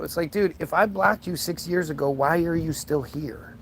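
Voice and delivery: ordinary talk, nothing marked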